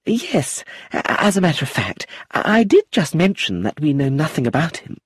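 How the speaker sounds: high, stumbling, embarrassed lady's voice; embarrassed aunt stammering to her niece; flustered. Acted. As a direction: stammer it out, caught gossiping. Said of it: "Lucilla blushed".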